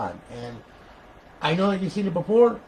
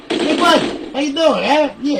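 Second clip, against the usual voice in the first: high, loud sing-song greeting; mock friendly taunt; 11 semitones higher, 8 dB louder, slower than usual. Real, not acted. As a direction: fake-friendly jeer at the victim. Mocking the camper again.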